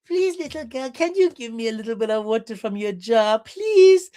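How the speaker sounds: high, pleading mock-sweet voice; exaggerated high-pitched coaxing; wheedling baby talk. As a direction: beg like you're cooing to a toddler, squealing the last please. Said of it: "And then he said".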